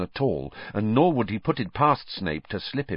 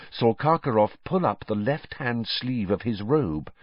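plain reading voice

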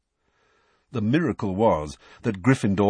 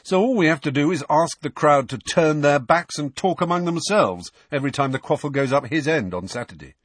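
sarcastic brother, pitched up and lively; higher, teasing character voice; Fred; 6 semitones higher than usual. Acted. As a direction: pitch it up, proposing a mock plan. Fred jokes, voiced by narrator.

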